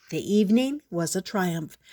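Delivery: plain reading voice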